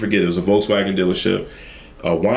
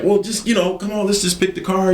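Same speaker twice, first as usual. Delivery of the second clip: higher pleading husband voice; husband coaxing; 9 semitones higher than usual. Retold or imitated. Husband urging his wife at the car lot, higher and pleading. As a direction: coax her along, pitched up.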